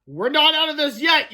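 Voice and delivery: high, loud warning; loud urgent yell. A narrator's reading. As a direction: shout a warning over the wind. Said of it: "yelled Fluffy".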